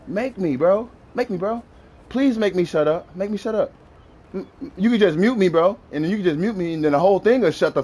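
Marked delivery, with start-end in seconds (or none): none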